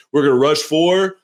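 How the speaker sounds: crappy presidential impression, slow and drawn out; Donald Trump. Coach at an introductory press conference, as a crappy Trump impression. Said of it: "crappy Trump impression right now".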